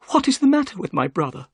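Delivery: lightly voiced worried host; anxious Sholto. A narrator's reading. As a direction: press for an answer. Sholto's voice in the story.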